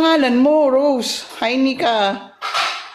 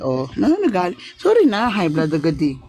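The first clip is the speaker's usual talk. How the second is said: ordinary delivery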